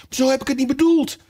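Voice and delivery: high, imploring voice; pleading. A narrator's reading. Alice apologizing to the offended mouse, pleading. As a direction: plead to be forgiven. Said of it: "zei de arme Alice smekend".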